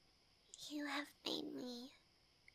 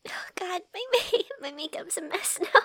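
tearful laughing; 4 semitones higher, 14 dB louder than usual. Acted. Crying after yes, makeup ruined.

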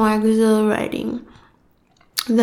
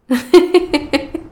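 falling villainous chuckle; evil laugh; 7 semitones higher than usual. Acted. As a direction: laugh down at the wretch. Goddess gloating after a threat.

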